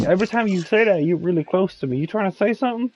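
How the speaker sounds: high teasing mock voice; teasing coworker. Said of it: "And then he was like".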